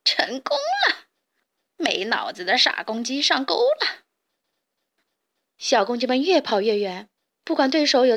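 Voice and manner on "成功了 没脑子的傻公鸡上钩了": gleeful sly weasel. A narrator's reading. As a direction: gloat in a squealing weasel voice. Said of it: "黄鼠狼边偷笑边往回跑".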